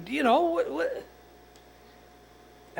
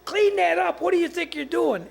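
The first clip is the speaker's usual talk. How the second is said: retold yell; 8 semitones higher than usual. Telling a dog owner to clean his lawn, mock yell. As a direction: re-enact telling the guy off. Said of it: "I yelled at the guy".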